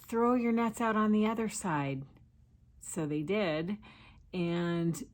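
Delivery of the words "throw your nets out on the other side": calling out. Retold or imitated. Voices Jesus calling to the boat.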